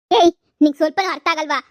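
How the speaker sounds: loud, angry "ಏಯ್" to open the scolding; angry outburst. Acted. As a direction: bark at her in frustration. Husband scolds wife over money.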